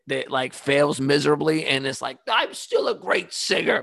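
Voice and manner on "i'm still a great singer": hint of a defiant Idol reject, pitched up; lightly mock a deluded singing contestant; failed auditioner. Failed Idol contestant insisting on talent, mock-defiant. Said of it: "that audition in American Idol that".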